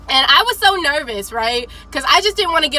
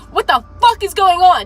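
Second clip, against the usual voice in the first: loud, raised demand; angry yell; 4 semitones higher, 4 dB louder than usual. Retold or imitated. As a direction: snap at the recruit. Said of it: "And he's like".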